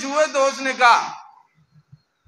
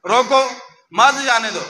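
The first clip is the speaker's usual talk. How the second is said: "रोको" alone, break, "मत जाने दो" together; pause after रोको. Shown as a demonstration. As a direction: misread it with मत joined to जाने. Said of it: "गार्ड के पास गई तो उसने पढ़ा".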